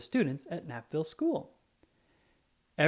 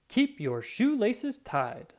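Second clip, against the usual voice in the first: slow, higher lecturing voice; slow safety tip; 7 semitones higher, much slower than usual. Acted. Officer lecturing schoolchildren on safety, slow and higher. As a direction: spell out the tip slowly.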